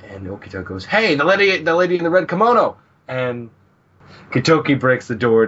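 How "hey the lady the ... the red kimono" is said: higher, louder and fast, pointing it out; excited shout. Acted. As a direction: blurt it out like you just spotted a ghost. Okita spots the ghost lady.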